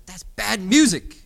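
put-on high, loud, slow little boy's declaration; child's loud verdict. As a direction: announce it like a small boy to the whole store. Son loudly judging store music.

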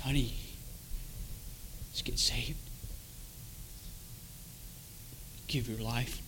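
hushed, low, near-whisper; soft pleading wife. Retold or imitated. Wife quietly pleading with her husband to get saved, hushed. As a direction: urge very gently under the breath. Wife gently urging, retold.

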